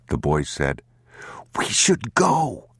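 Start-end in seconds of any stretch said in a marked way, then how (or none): 1.6-2.7 put-on high, small child's voice; a scared young boy tugging at his father; boy's voice. A narrator's reading.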